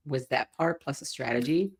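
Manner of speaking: normal talking voice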